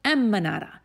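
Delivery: ordinary delivery, nothing marked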